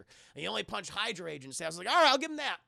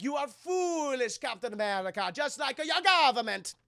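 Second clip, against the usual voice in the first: put-on Italian villain accent; 5 semitones higher, 7 dB louder than usual. Red Skull gloats.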